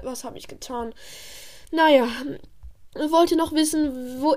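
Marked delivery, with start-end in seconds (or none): 0.0-0.9 softer exasperated mutter to herself; a quiet, weary what-have-I-done; muttered aside. Acted. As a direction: groan it under your breath.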